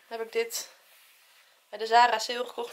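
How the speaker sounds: normal talking voice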